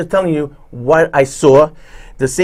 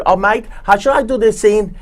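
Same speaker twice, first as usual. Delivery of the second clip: put-on Australian accent; 6 semitones higher than usual. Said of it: "No, she's Australian".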